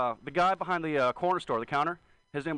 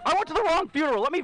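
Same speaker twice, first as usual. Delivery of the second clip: exasperated outburst; 13 semitones higher, 5 dB louder than usual. Real, not acted. Comic venting loudly.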